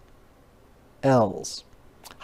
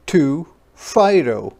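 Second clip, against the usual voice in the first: normal talking voice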